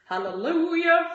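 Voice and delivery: a high, loud burst of joy; excited cheer. Real, not acted. Celebrating being cleared to train, a high loud hallelujah. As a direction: cheer it out like good news.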